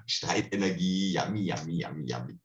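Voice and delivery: low, greedy yummy that fades to almost nothing; greedy spirit voice. Retold or imitated. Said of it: "eine Wesenheit sitzt da und sagt".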